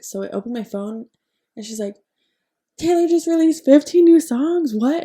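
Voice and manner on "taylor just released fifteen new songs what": high, loud frantic announcement; excited sister. Retold or imitated. Sister texting about the release, high loud excitement. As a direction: blurt the news breathlessly.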